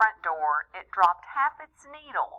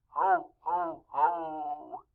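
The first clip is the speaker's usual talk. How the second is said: Santa roar; 3 semitones lower, 3 dB quieter, much slower than usual. Acted. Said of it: "Santa roared".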